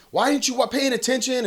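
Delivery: high-pitched mock telling-off; imagined scolding. Dad imagining blaming the older brother, mock scolding.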